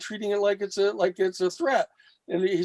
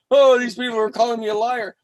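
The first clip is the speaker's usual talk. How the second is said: upset; 5 semitones higher, 6 dB louder than usual. Retold or imitated. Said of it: "Lou was all upset".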